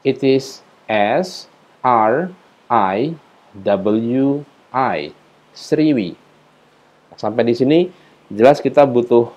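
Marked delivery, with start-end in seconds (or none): 0.1-6.1 spelling out slowly. Shown as a demonstration. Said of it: "seperti ini contohnya".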